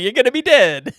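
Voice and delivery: loud mock yell, pitched high; imitated yelling. Retelling him with knife in hand, mock yelling. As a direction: shout it like a comic accidental threat. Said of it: "got this knife in his hand yelling".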